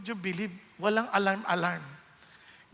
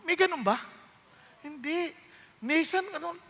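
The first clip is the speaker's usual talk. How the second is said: ordinary talk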